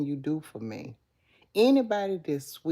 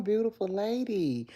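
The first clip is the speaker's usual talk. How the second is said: ordinary talk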